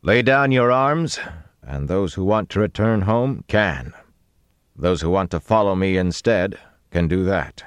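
booming. A narrator's reading. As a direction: address hidden men so every word carries. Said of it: "they boomed against the wall".

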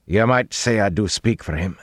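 cautious character voice; wary reply. A narrator's reading. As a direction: answer warily, a little on guard. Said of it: "the narrow-faced man replied warily".

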